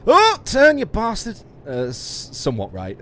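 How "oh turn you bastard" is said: high yelled "turn, you bastard"; re-shout it as a joke quote; mock quote shout. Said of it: "[name] always said".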